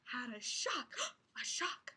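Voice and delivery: breathy hushed gasp; dramatic whisper. Acted. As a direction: whisper it to build suspense.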